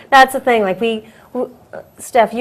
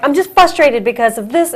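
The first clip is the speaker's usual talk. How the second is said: raised, louder mock venting; imitating own frustration; 4 semitones higher, 4 dB louder than usual. Co-host showing how she complains when upset, mock frustrated. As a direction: act out getting worked up. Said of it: "that's how I say it".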